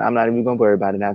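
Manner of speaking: ordinary delivery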